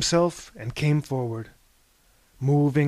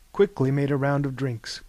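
ordinary delivery, nothing marked

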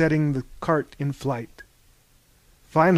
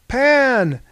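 high, loud call of a name; yelled greeting; 10 semitones higher, 8 dB louder than usual. A narrator's reading. The group greets Pan at the door, yelling. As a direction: yell a welcome across the room. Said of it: "yelled the group".

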